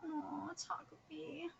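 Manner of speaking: tiny, very soft whispered aww; moved whisper. Real, not acted. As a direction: whisper it, touched by the farewell. Moved after reading the farewell.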